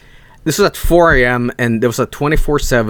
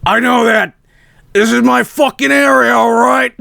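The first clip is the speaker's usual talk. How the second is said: higher, louder crackhead voice building to a shout; crazed crackhead; 10 semitones higher, 3 dB louder than usual. Retold or imitated. Crackhead shouting at the speaker outside the hotel, higher loud mock yell. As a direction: bark it like an unhinged guy defending his turf. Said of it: "And he goes".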